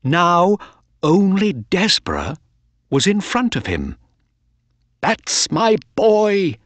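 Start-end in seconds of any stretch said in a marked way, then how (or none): 5.0-6.6 proud. A narrator's reading. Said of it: "said Mister Ross".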